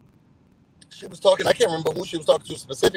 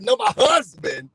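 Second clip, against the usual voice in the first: mock exasperated shout; 10 semitones higher, 5 dB louder than usual. Retold exasperated correction amid panic.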